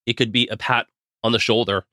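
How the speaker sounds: usual speaking voice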